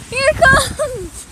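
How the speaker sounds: excited shouting. Real, not acted. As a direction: shout a warning, laughing. Gust rushing at him.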